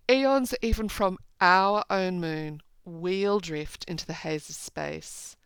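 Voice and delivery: slow, measured poem recital; deliberate reading of verse. Acted. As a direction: read the lines with weight. Rereads the same lines.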